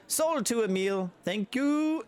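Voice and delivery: high, sing-song sale call; auctioneer. Acted. Auctioneer closing the sale to Emil, high sing-song. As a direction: close the sale cheerfully.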